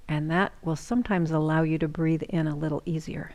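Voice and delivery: ordinary delivery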